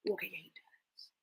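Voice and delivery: soft whispered aside. Real, not acted. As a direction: whisper it to yourself.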